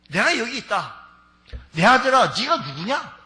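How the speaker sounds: put-on old father calling. Preacher voicing blind father asking his son's name. Old Isaac answers son.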